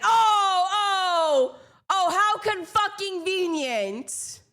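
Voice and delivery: hammed-up indignant sarcasm; sarcastic mock outrage.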